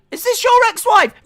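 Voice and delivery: loud, very high mock shriek; mock outraged bride. Bride confronting groom over ex-wife, mock shriek. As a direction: demand it in a high put-on shout. Voices Indy confronting Dexter.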